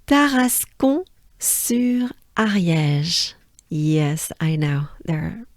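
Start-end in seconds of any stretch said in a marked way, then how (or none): 0.0-3.3 Tarascon-sur-Ariège drawn out slowly; slow teaching pronunciation; slow careful name. Shown as a demonstration. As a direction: spell it out by ear, slowly. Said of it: "they will arrive in".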